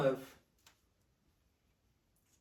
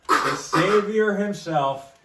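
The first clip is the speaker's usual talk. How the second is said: slow, loud and high ring intro; hype announcer; 6 semitones higher, 8 dB louder, much slower than usual. Acted. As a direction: build it up like an announcer. Hyping Punk's entrance like announcer.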